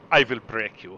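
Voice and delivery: low mock Russian accent. Co-host playing a menacing Russian, low Russian accent. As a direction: intone it in a deep Russian accent. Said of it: "I gotta get my Russian accent going".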